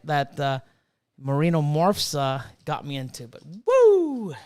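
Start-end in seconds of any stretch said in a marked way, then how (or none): 3.7-4.3 high excited cheer; excited whoop. Real, not acted. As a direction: let out a happy whoop. Caffeine rush cheer.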